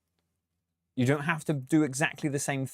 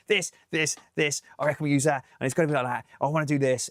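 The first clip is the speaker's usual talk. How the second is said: higher, driving mock confidence; imitated self-assurance; 5 semitones higher, 4 dB louder, faster than usual. Producer imitating best artists directing a session, self-assured. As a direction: rattle out ideas like an artist who never doubts. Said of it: "they're so self-assured with their music".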